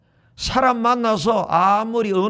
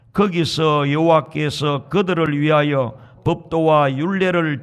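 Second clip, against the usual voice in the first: slow, low, measured group Bible reading; scripture reading; 5 semitones lower than usual. Real, not acted. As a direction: lead the congregation through the verse, lower and drawn out. Leads congregation in scripture reading. (낮고 느린 성경 봉독)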